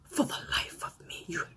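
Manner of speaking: hushed, breathless whisper. Real, not acted. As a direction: lean in and whisper the shock. Shocked aside to viewers.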